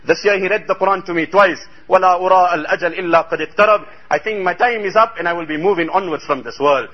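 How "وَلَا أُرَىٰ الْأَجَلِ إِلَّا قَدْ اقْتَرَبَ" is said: fast Arabic recitation. Real, not acted. Hadith quoted in Arabic.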